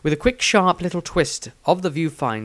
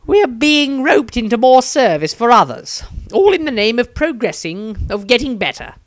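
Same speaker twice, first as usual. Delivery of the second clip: higher, louder voice of a tired, resigned wizard; weary wizard; 10 semitones higher, 7 dB louder than usual. Acted. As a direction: sigh through it, fed up with fate. Maestro sighs and answers.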